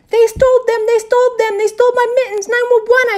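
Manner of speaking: very high, loud repeated yelling; frantic shout. A narrator's reading. Girl reports theft to the teacher, shouting frantically. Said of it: "I shouted".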